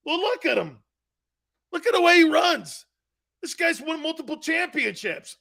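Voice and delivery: high-pitched excited admiration; imitated grandfather raving. Grandfather now praises Franco for being Italian, excited gushing.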